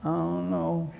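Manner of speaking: low, soft, sheepish shrug of a reply. Acted. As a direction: mumble it like you have no idea. Widow asked about the oil.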